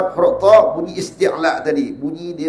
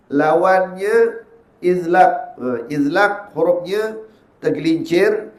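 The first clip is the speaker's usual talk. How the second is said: ordinary talk, nothing marked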